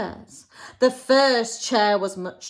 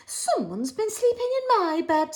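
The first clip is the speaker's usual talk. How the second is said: Mother Bear; 8 semitones higher than usual. Acted. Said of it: "said Mother Bear".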